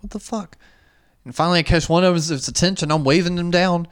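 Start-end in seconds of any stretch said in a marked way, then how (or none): 0.0-0.6 muttered disbelief. Retold or imitated. Seeing staff inside locked store.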